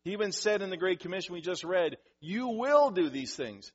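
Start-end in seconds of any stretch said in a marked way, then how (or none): 2.2-3.7 loud, slow stress on "will"; emphatic certainty. Retold or imitated. Preacher quoting Jesus' Great Commission, emphatic stress on "will". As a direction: raise the pitch and punch "will". Contrasts certainty with mere possibility.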